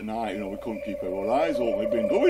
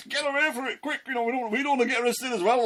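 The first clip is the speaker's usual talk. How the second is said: much higher, fast, panicky urging; panicked fleeing; 12 semitones higher, faster than usual. Retold or imitated. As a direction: gabble it out as you run off. Disciples fleeing arrest in panic.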